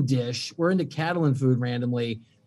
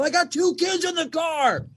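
higher, indignant raised voice; yelling at driver; 12 semitones higher than usual. Retold or imitated. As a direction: shout it out the car window. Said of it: "and I go".

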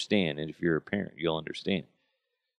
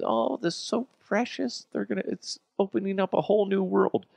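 high, sappy cooing voice; Facebook friends cooing over baby food, high and sappy; gushing mock voice; 13 semitones higher than usual. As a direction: coo it like an adoring fan. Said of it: "Everybody was responding with".

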